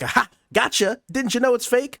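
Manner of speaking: smug gotcha. Retold or imitated. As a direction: gloat like you caught them out. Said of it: "you're telling them a world-shattering realization".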